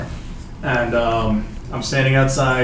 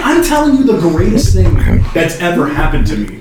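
retold incredulous outburst, higher and louder; exasperated excitement; 5 semitones higher, 8 dB louder than usual. Man retelling wild bar story to unimpressed date, excitedly. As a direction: play up the big news, raised and loud.

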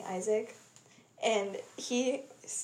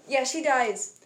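ordinary delivery

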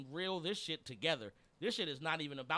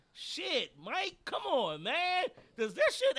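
exasperated; 9 semitones higher, 5 dB louder, slower than usual. Real, not acted. Frustrated call to the coach.

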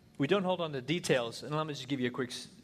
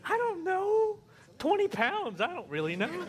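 very high, flustered guessing; helpless husband; 4 dB louder than usual. Retold or imitated. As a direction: guess wildly at the weight. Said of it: "husband answering".

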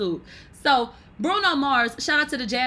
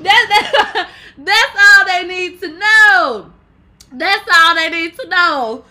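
loud, higher, slow laughing shout; 6 semitones higher, 11 dB louder, slower than usual. Real, not acted. Proud of being called an icon, loud laughing shout. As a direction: shout it slowly while cracking up.

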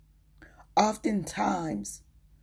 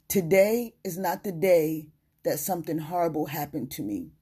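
normal talking voice

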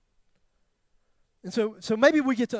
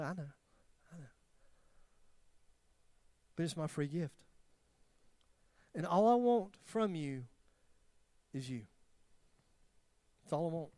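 much softer, tender voice of God; 2 semitones lower, 6 dB quieter than usual. Retold or imitated. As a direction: speak love gently to a broken soul. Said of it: "he'll say".

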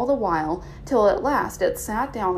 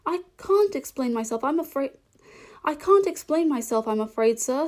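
higher, lightly voiced Alice; meek, higher-pitched reply; apologetic Alice; 6 semitones higher than usual. A narrator's reading. Alice answers stern caterpillar, voiced.

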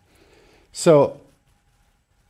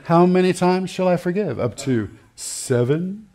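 Peter asking; 5 semitones higher than usual. Acted. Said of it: "Like this".

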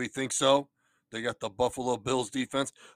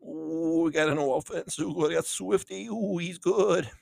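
Elmer Fudd voice; 4 semitones higher than usual. Acted. Elmer voice continues.

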